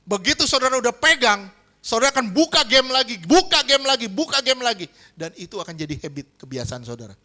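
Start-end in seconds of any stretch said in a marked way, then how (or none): 0.1-4.9 fervent preaching. Acted. Preacher at full force.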